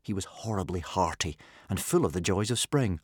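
ordinary delivery, nothing marked

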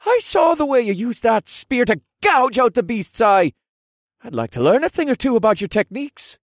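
high, loud, keen fighter's voice; eager young warrior. Acted. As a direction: ask eagerly, full of admiration.

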